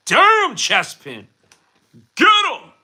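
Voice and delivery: a real excited yell at the chat. Real, not acted. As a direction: yell it like cheering on a fighter. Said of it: "Sorry, I just yelled that".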